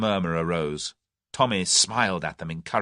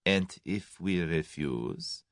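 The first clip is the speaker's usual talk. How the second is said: said quietly; 2 semitones lower, 6 dB quieter, much slower than usual. A narrator's reading. The German quietly testing Tommy's bargain, soft and slow. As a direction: ask it slowly, hushed and cold. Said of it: "said the German quietly".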